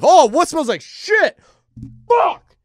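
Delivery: hammed-up shout of disgust at a smell; mock outburst. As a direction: pretend disgust that jumps up high and loud. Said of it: "dude, I'll be like".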